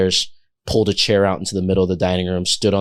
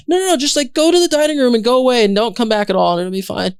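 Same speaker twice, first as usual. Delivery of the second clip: high, loud pushy brush-off; panicked cheerful cover-up; frantic shooing; 4 dB louder, faster than usual. Retold or imitated. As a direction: hurry her out the door. Shooing boss out.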